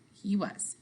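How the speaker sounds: ordinary delivery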